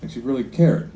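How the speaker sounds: ordinary talk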